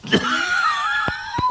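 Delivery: harsh coughing; rough cough; coughing fit. Real, not acted. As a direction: cough hard. Coughing with a cold.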